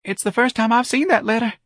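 very high, anxious denial; piping. A narrator's reading. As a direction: pipe it up in a tiny high voice. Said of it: "Mister Gonzalez piped".

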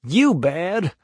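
high-pitched upset disbelief; tearful protest. A narrator's reading. Huckleberry disputes Tom's wickedness, on the edge of snuffling. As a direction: protest it on a high, wounded note. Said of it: "began to snuffle too".

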